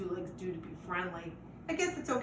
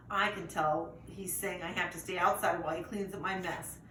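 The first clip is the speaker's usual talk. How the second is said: plain reading voice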